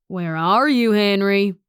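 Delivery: loud Southern drawl, calling out; booming Southern-accented call; thick Southern accent. A narrator's reading. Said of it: "such a thick Southern accent".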